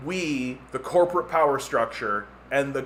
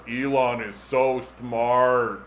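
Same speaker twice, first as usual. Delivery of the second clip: slow, lower, dazed repeating; hypnotized fan; 4 semitones lower, much slower than usual. Retold or imitated. Fan hypnotized by phone apps, slow dazed chant. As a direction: drone it like someone under a spell. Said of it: "making people go, like".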